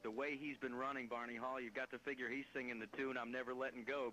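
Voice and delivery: ordinary talk, nothing marked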